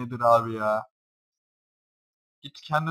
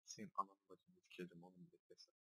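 ordinary talk

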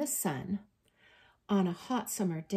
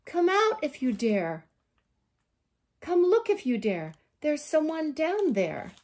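raised, loud storybook call; calling out a challenge; 7 semitones higher, 6 dB louder than usual. A narrator's reading. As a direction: call down into a hole. Badger calls into a hole.

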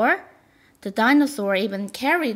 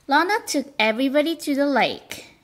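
usual speaking voice

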